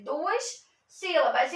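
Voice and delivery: normal talking voice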